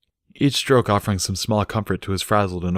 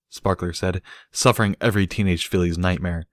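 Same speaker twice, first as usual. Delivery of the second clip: plain reading voice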